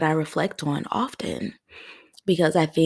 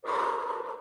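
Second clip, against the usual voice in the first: long airy exhale to hold back tears; emotional sigh; 10 dB quieter than usual. Real, not acted. Podcast host collecting herself mid-episode, emotional sigh. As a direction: breathe out slowly to regain composure. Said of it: "I'm gonna get emotional".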